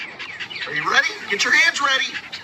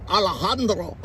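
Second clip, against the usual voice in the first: imitating an overdone Mexican accent; mock Mexican accent. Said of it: "sound like you're Mexican".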